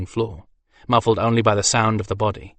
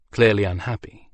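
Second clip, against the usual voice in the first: ordinary delivery, nothing marked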